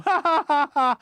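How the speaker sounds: loud, high hammed-up laughter; hammy fake laugh. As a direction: overdo a smug know-it-all laugh.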